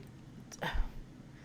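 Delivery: soft, drawn-out breath out; exasperated sigh. Real, not acted. Vlogger recalling the slow transaction, exasperated sigh. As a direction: sigh softly over the delay.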